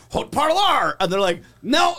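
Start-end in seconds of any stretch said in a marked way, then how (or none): 0.1-1.0 loud, higher French with hard English r; heavy Anglo accent. Retold or imitated. Actor failing the correction, stubbornly heavy Anglo accent. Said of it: "that's a heavy Anglo accent". 1.6-1.9 high, loud shouted no; exasperated yell. Retold or imitated. Crew correcting actor on a crane, exasperated shout.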